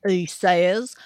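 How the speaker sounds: usual speaking voice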